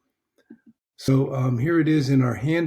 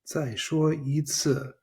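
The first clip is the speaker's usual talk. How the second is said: slow model phrase; 3 semitones higher, slower than usual. Shown as a demonstration.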